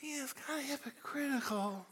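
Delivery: soft, hesitant, apologetic hedging; polite, understated, trailing off softly; mock timid Canadian. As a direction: hedge it meekly, fading away. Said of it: "Jesus wouldn't be like".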